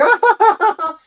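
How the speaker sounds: high, loud imitation cackle; mock giggling cackle. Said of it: "while laughing, giggling".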